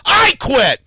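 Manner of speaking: high, loud hammed-up wail; host hamming up quitting at cohost, loud mock wail; mock shouted quit. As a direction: throw a fake tantrum.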